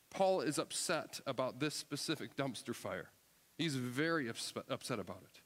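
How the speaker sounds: ordinary talk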